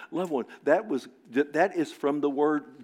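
ordinary talk, nothing marked

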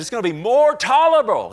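loud, high, near-yelled quote; almost a shout. Retold or imitated. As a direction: belt it out like Jesus warning them. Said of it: "That's almost a shout".

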